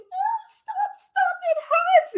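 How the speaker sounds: extremely high, loud doll wailing; hammed-up high-pitched pleading; toy in pain. Toy pleading as its fabric tears, high pained little-girl wail. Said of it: "And she's just like".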